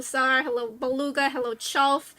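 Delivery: ordinary talk, nothing marked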